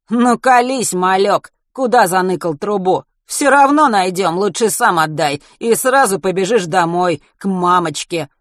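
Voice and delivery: loud, high menacing thug; hoarse thug threat. Acted. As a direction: threaten him with a sneer.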